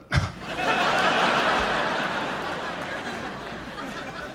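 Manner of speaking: silent wheezing high laugh; high breathy laugh. Real, not acted. Comedian laughing at his own pilot bit, breathless laugh.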